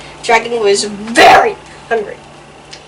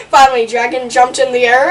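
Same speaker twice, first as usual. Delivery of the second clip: ordinary delivery, nothing marked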